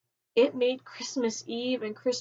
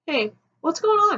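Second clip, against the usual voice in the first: higher, louder, breezy greeting of his mom; his mom answering; 5 semitones higher, 5 dB louder than usual. Retold or imitated. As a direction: chirp it like an unsuspecting mom. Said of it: "and she answers".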